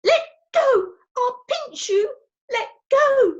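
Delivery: put-on high, loud childish scream. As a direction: shriek at him to let go. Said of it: "She screamed".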